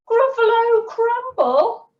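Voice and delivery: very high, loud mock-horrified squeal; Gruffalo's alarm. A narrator's reading. Gruffalo fears being eaten, high loud acted alarm. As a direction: echo it in horror, pitched right up. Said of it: "the Gruffalo said".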